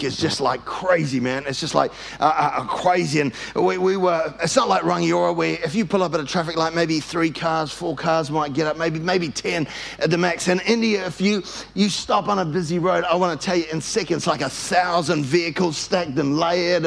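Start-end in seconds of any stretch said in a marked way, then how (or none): none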